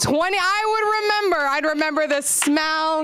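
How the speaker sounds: high, wailing fake sob on "twenty, I would remember"; mock crying. Mock trauma bit about rabbi.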